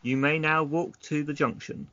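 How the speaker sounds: normal talking voice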